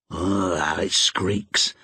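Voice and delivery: a hint of old Joe muttering; grumbling old Joe. A narrator's reading. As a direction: grouse about the creaky hinge.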